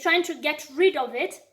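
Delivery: normal talking voice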